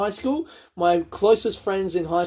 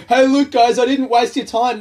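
excited; 6 semitones higher, 7 dB louder than usual. Real, not acted. Defending himself to viewers.

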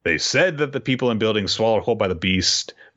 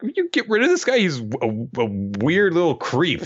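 high, mock disgusted brush-off; disgusted Oden; 9 semitones higher than usual. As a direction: wave him off in distaste.

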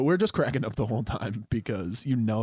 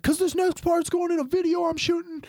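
high, shouted country-star hype; hammed-up arena announcement; 3 dB louder than usual. Singer hyping the crowd for his video, high stage shout. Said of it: "I, I'm [name]".

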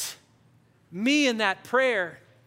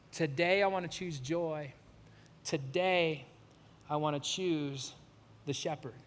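prayer; 5 semitones lower than usual. Acted. Leads a closing prayer.

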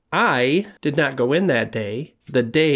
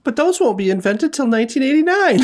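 much higher nerdy objection; high squeaky voice; 6 dB louder than usual. Acted. In-character squeaky voice.

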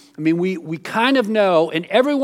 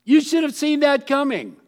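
told-you-so; 8 semitones higher than usual. Retold or imitated. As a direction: repeat it, knowing and exasperated. Telling a friend off.

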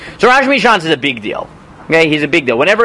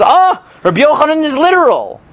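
higher-pitched, acted-out "oh!" of discovery; sudden realization; 9 semitones higher than usual. A student sees the diamonds and catches on, sudden realization. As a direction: play the student as it dawns on him.

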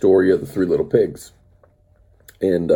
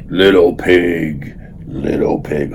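slow, lower wolf call; wolf at the brick house, slow and lower; 6 semitones lower, slower than usual. Acted. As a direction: draw it out like a lurking wolf. Wolf at third pig's door.

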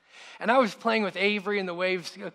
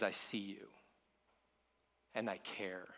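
tender reassurance; 9 semitones lower, 13 dB quieter than usual. Retold or imitated. God speaks to the listener.

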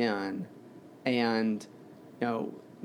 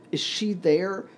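higher, urgent question, mildly raised; 6 semitones higher than usual. A narrator's reading. Child asks about his dead mother, in a mildly raised voice. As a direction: lift the pitch into an anxious question. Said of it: "he said in a loud voice".

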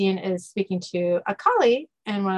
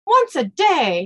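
louder, higher, drawn-out repeat; indignant complaint; 7 semitones higher, 6 dB louder, slower than usual. Acted. Kennel dog griping to Dean, indignant. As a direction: complain it slowly and emphatically, pitched up.

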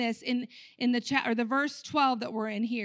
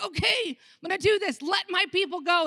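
pumped up; 7 semitones higher, 4 dB louder than usual. Acted. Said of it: "took a little Red Bull".

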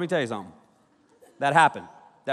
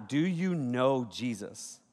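slow, low and softer, dead serious; slow solemn question; 4 semitones lower, 6 dB quieter, much slower than usual. Acted. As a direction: ask it like a deadpan street preacher. Opening line to the crowd.

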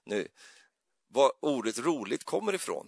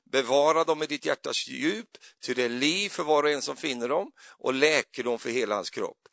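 louder, solemn Bible reading; scripture reading; 3 semitones higher, 4 dB louder than usual. Acted. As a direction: read the verses with solemn weight. Reads Proverbs aloud.

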